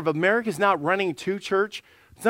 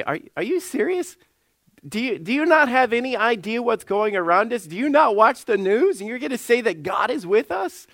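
higher-pitched, disbelieving questions; incredulous challenge; 5 semitones higher than usual. Acted. Doubters answering a claim that God is in control, incredulous. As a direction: push each question up in mock disbelief.

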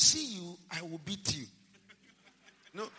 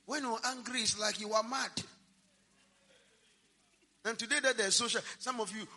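usual speaking voice

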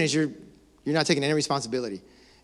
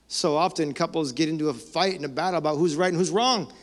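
usual speaking voice

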